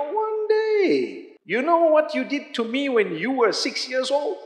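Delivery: high-pitched mock mother's reproach; reproachful mother. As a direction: imitate a mother dredging up an old grudge. Mother voicing a long-held grudge.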